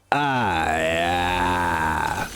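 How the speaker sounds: low, drawn-out mock moan; porn star gamer. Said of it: "when a porn star is a gamer".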